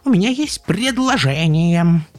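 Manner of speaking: high, loud, drawn-out delight; gleeful piping proposal; delighted chick. A narrator's reading. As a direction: announce it joyfully in a chick voice. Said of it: "обрадовалась тут-то Карлсон".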